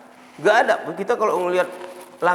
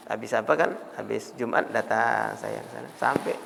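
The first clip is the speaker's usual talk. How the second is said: ordinary talk, nothing marked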